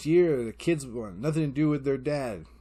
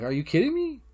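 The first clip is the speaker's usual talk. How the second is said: high-pitched disbelief, rising sharply; retold "can you believe this" outburst; incredulous; 10 semitones higher, 4 dB louder than usual. Dad answering his shrugging kid, incredulous.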